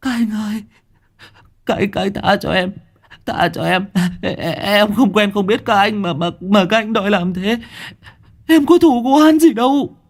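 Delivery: high, frightened begging voice; terrified stammering plea. Acted. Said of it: "nó lắp bắp". (giọng run rẩy van xin)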